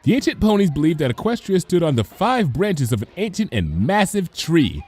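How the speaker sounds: lightly voiced patient teacher; cheerful teacher. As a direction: explain it kindly to a class of foals. Teacher Cheerilee explains to class.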